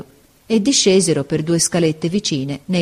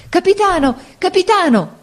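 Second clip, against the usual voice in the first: very high, pleading calls; desperate shouting; 13 semitones higher, 3 dB louder than usual. A narrator's reading. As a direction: cry out for the captain. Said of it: "gridarono tutti insieme".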